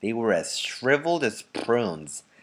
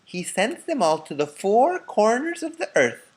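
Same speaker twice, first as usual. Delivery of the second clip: put-on much higher old lady voice; an old woman seeking agreement; high elderly woman's voice; Grandma Josephine; 13 semitones higher, 3 dB louder than usual. A narrator's reading.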